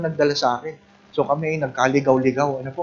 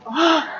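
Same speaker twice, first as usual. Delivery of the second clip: high, drawn-out "ah"; theatrical scandalized gasp; mock shocked gasp; 13 semitones higher than usual. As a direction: feign horror with a long high gasp. Mock gasp at petty sin.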